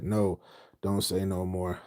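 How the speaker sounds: ordinary talk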